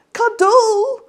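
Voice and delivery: very high, loud wailing howl; child howling. Acted. Petey howls at Mum for a cuddle, high wailing cry. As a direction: wail it, close to tears. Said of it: "he howled".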